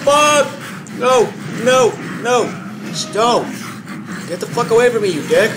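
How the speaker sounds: loud, high, frantic screaming; panicked yelling. Real, not acted. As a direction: scream it as he grabs you. Caught by monster again.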